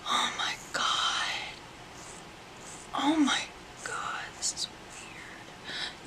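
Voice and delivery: soft whisper, stretched out at the end; stunned whisper. Real, not acted. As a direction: whisper it in disbelief. Stunned mid-haircut.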